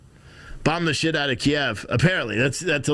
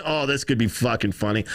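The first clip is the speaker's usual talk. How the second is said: ordinary talk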